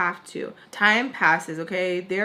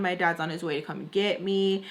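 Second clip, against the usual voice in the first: usual speaking voice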